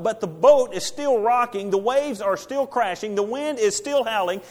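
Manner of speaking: loud, high, driving storm sermon; preaching at full force. Acted. Preacher to congregation, loud and rising.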